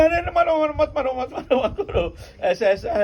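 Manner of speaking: high-pitched panicked begging, words tumbling over each other; frightened pleading. Acted. Woman begs the devotee not to beat her, frightened pleading. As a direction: plead in mock terror, voice shot up high. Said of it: "डर के".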